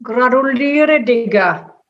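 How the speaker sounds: put-on character voice, mildly triumphant. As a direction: congratulate a pal in triumph. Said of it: "wandte sich triumphierend zu Bertram".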